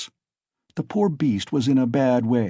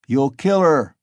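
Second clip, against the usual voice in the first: louder warning cry; shouting; 3 semitones higher, 5 dB louder than usual. Acted. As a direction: warn him from the crowd. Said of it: "shouted the third".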